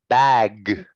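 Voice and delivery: "bag" with a drawn-out voiced g; voiced g ending. Shown as a demonstration. Teacher showing student how "bag" ends, voiced g. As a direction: let the g in "bag" stay buzzing. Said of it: "this one is".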